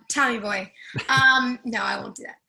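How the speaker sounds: normal talking voice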